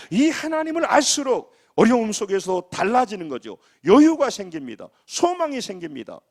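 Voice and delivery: high, forceful sermon voice; impassioned preaching. Real, not acted. Pastor urging congregation to find hope in God, impassioned preaching.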